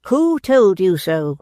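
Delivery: a lightly voiced stern mother; stern demand. A narrator's reading. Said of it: "still spoke aloud when she demanded".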